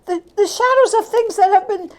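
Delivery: high, breathless joy; giddy relief. Acted. Scrooge finding himself home in bed, giddy and high. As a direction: bubble over with disbelieving delight.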